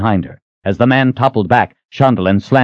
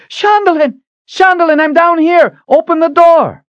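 calling out; 5 dB louder than usual. Acted. Calling up from the pit.

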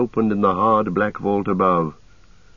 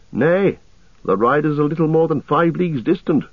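high, lilting elf voice; elven scout; 7 semitones higher than usual. A narrator's reading. Legolas reports the distant riders, high elf voice. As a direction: report the sighting in a raised, light pitch.

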